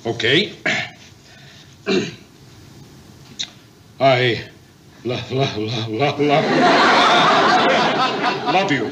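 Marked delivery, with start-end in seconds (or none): 4.0-8.9 stuck on the word, then laughs; stammering. Acted. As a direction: stammer over love nervously. Struggles to say love you.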